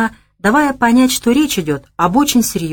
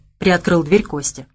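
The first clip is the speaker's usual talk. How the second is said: ordinary delivery, nothing marked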